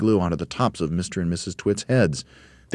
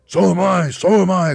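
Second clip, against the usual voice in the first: high, loud, panicky repetition; crying out; 13 semitones higher, 9 dB louder than usual. A narrator's reading. Mr Twit feels giddy like his wife, crying out. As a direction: cry it out in dismay. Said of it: "cried Mister Twit".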